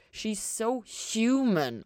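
very slow, heavy irony; drawn out so it means the opposite; slow, weighted ironic tone. A narrator's reading. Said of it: "in a tone that implied she wasn't".

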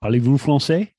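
Parlez-vous français in a put-on French accent; mock French. Host teasing co-host about his French, mock French accent. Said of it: "how's your French".

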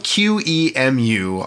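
Q E M U slowly, letter by letter; each letter of Q E M U named separately; spelled out. Shown as a demonstration. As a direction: spell Q E M U as "cue, ee, em, you". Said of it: "it's q-, spelled".

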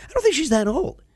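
high-pitched doubting onlooker; high voice of polite disbelief; retold bystander. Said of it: "And everybody's going".

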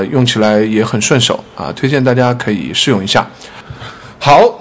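ordinary talk, nothing marked

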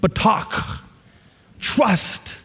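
high, breathed-out emphatic word; emotional reading. Shown as a demonstration. Preacher reading the Hebrew word so it shows emotion. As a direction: set each word apart with feeling. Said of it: "show emotion".

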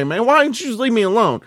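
ordinary talk, nothing marked

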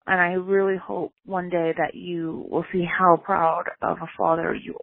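ordinary talk

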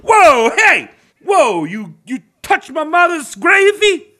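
high, indignant Italian-American outburst; cartoon Italian-American accent. Driver accusing cop of touching mother's gravy, mock Italian-American accent. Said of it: "it's my internal Italian racist".

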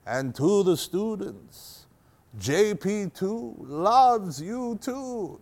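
put-on very slow, soft, laboured accented voice; hushed, halting old-pope impression with an accent; Pope John Paul II. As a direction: imitate a frail pontiff, word by drawn-out word. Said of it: "we'll just drop the accents".

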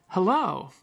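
everyday "Hello" falling at the end; a settled, falling "Hello"; ordinary older hello. Retold or imitated. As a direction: say "Hello" with the second syllable dropping. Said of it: "people would say often".

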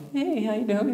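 put-on high, breezy hello to the kids; friendly greeting. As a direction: cheerily greet the smiling kids in the next car. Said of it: "So I'm like".

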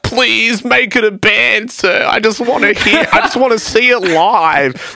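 high, drawn-out begging; mock pleading. Fans wanting the studio project live, mock pleading. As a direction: whine it high and slow like a beggar.

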